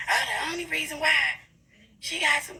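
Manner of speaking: high, sneering mimic of a gossiping woman; mock her words back at her, high and snide; a hammed-up catty voice. Mocking another woman's gossip.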